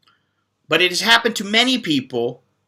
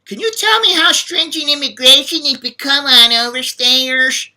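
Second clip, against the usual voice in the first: higher, mocking whiny imitation of the asker's question; 7 semitones higher than usual. YouTuber mocking a viewer's question, high and whiny. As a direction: parrot the question back like a nervous kid. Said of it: "when you ask me this question".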